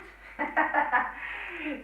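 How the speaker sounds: bright self-amused laugh; real laugh at herself. Real, not acted. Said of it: "I could do with some of that".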